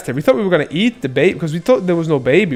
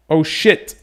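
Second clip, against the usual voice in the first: usual speaking voice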